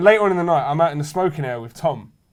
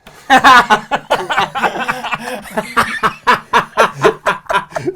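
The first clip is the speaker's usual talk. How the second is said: long, high, loud laughing fit; helpless high laugh; 8 semitones higher, 6 dB louder than usual. Real, not acted. Cracking up at the punchline of his fight story. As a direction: laugh until you can't stop. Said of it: "die laughing on the floor".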